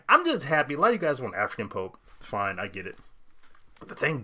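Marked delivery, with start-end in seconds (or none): none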